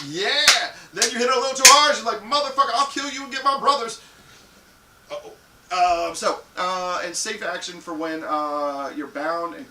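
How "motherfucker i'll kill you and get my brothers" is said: higher, louder hammed-up rage; mock furious threat. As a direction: threaten him, playing it big. Partner threatens after hard hit.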